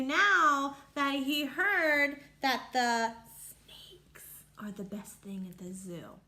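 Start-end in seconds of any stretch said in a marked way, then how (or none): 3.4-6.2 whispering. Real, not acted. Teacher whispering to children to build suspense.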